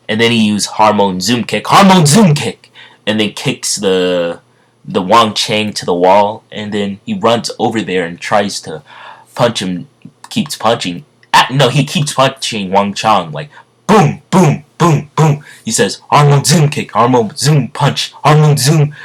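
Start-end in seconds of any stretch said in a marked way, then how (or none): 1.7-2.7 much higher, loud attack shout; hammed-up fighting yell; battle cry. Acts the hero's attack cry. 13.9-15.4 higher, punchy "boom" noises; vocal booms, each hit hard; punch sound effects. Retold or imitated. Imitates repeated punches landing. 16.1-18.9 higher, loud attack calls; hammed-up fighting yells; battle cry. Calls out attack names while fighting.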